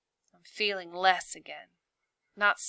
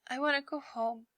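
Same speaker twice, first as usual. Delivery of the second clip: small teary voice, a touch higher and softer; a hurt girl who wants to leave, near tears; higher, softer, tearful plea; sniffling; 6 semitones higher, 4 dB quieter than usual. A narrator's reading. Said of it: "sniffs Sabine".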